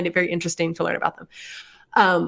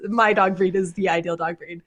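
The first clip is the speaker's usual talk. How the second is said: usual speaking voice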